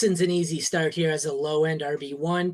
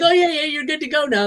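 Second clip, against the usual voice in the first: much higher, casual mock voice; breezy customs agent; 11 semitones higher, 5 dB louder, faster than usual. Imitating the customs agent waving him through, high and breezy. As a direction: toss it off like an offhand border guard. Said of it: "he's like".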